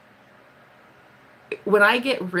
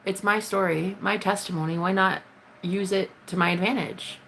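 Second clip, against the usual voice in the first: normal talking voice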